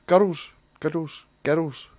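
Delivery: put-on "girls" with the R rolled; Scottish "girls" with a rolled R. Shown as a demonstration. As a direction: tap or roll the R in "girls". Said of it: "is that rolling the R thing?".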